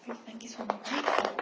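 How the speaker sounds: whisper. Real, not acted. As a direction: whisper the question fast, teasing. Whispered question to kids.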